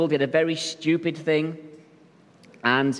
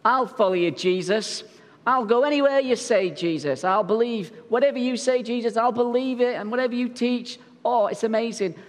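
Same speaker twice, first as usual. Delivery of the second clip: higher, gushing eager followers; eager disciples; 5 semitones higher than usual. Retold or imitated. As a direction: gush with keen devotion. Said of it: "And they're like".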